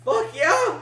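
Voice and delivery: high cracking shout of triumph. Acted. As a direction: let the voice crack on the yell. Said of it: "And my voice cracks".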